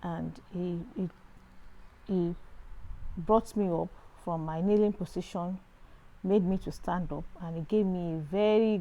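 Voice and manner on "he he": ordinary delivery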